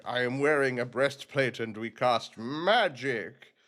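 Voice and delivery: slow, hammed-up mage-knight voice; mock-pompous armored spellcaster; griffin-school warrior. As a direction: declaim it grandly, drawing out "magic". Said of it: "the griffin is very, like".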